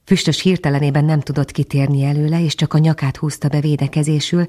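ordinary delivery

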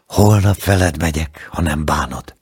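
plain reading voice

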